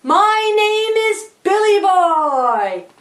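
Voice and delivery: high, slow, stretched-out holler; screamed out. Acted. Boy screaming his name to the crowd, high holler. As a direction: announce yourself at a drawn-out yell. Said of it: "And he screamed out".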